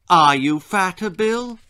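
worried mother. A narrator's reading. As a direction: ask it in alarm. Mother questions.

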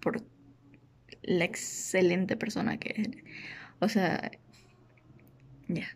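tearful whisper, nearly crying; breath-only words as tears come; choked up. Real, not acted. A sister fighting tears on her brother's birthday. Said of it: "voy a llorar de nuevo".

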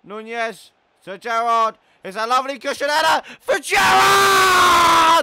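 loud, high commentary building to a long yell; excited commentator. Acted. Gamer calling Nunez to Gerrard, excited commentator yell. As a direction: build to a roaring goal call. Commentates his own attack.

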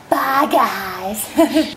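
low spooky goodbye; mock-creepy bye to viewers. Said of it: "say bye in a creepy voice".

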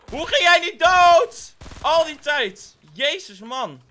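high, loud disbelieving yell; shocked shout. Real, not acted. Yelling at a teammate who survived, shocked.

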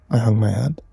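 usual speaking voice

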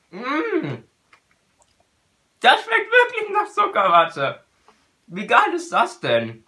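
chewing while talking; talking with food in mouth; mouth full. Real, not acted. Seen 0: Ate a grape at -7, hand at chin, still chewing early on.

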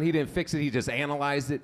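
ordinary talk

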